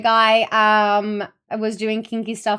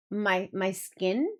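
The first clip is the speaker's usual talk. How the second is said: ordinary talk, nothing marked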